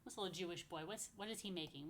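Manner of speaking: ordinary delivery, nothing marked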